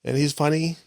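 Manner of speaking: a hint of a kid describing his friend; young son's voice.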